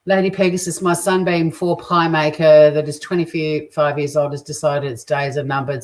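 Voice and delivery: ordinary talk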